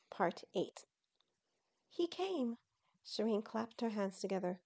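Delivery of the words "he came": high, thrilled exclamation; delighted cry. Acted. Shireen clapping at his arrival.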